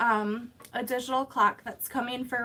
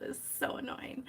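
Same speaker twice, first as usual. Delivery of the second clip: whispered aside; 2 semitones higher, 12 dB quieter than usual. Real, not acted. Frustrated aside about her laptop.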